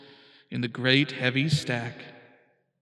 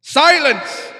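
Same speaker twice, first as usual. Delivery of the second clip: much higher and louder angry bark; barked command; 12 semitones higher, 12 dB louder than usual. A narrator's reading. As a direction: snap it like a furious king. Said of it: "the king of the turtles barked back".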